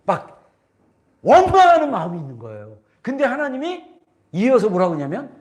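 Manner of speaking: ordinary delivery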